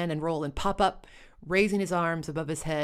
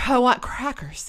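ordinary delivery, nothing marked

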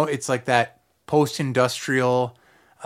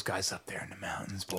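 hushed whisper, conspiratorial; whispered joke about mountain folk; whispered aside; 5 semitones lower, 17 dB quieter than usual. Real, not acted.

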